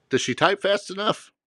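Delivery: usual speaking voice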